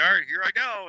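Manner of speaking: high, eager self-quote; high, pumped-up mock line. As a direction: psych yourself up to charge. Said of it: "And I was like".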